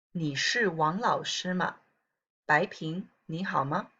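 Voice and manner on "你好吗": usual speaking voice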